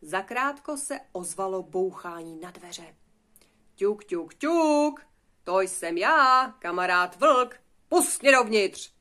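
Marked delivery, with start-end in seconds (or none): 3.8-8.9 loud, drawn-out wolf call at the door; insistent wolf. Acted. Wolf at the wooden house demanding to come in, insistent. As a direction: call out loudly to be let in.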